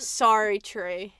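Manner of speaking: "Sorry, tree" drawn out as mock-Australian "so true"; Australian accent. Shown as a demonstration. Correcting co-host's attempt, deliberate mock Australian accent. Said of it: "doing that with Australian accent".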